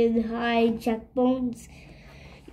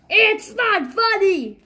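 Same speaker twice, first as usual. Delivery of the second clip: higher, louder "it's not funny"; exasperated protest; 7 semitones higher, 3 dB louder than usual. Acted. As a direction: protest with rising frustration. Dogman protests again, louder.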